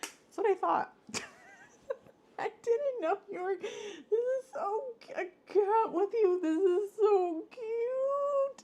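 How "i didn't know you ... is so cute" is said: very high, tearful and slow at the end; happy crying. Real, not acted. Vlogger thanking Mary for the gift, crying. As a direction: cry with gratitude.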